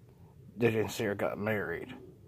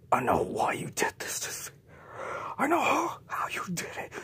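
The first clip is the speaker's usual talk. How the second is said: crying whisper; 12 semitones higher than usual. Acted. J J confronts the countess.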